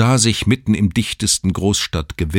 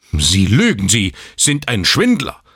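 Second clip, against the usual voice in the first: angry accusation; 5 semitones higher, 5 dB louder than usual. A narrator's reading. Father accuses the newborn.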